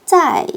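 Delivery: 짜이 starting high and falling sharply; fourth tone. Shown as a demonstration. Teacher demonstrates the tone, 짜이 in falling fourth tone. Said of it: "여기에 사성입니다".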